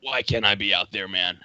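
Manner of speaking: low, raspy American surfer dude; wistful surfer bro in an American accent, pitch sinking. Shown as a demonstration. Surfer longing for the waves outside his window, raspy American. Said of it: "more raspy for the surfer vibe".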